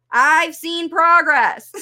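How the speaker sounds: loud, slow, drawn-out declaration; mock-emphatic proclamation. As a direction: proclaim it with playful exaggeration. Mock reading of the listener's claim.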